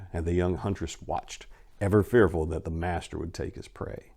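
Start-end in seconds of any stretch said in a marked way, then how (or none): none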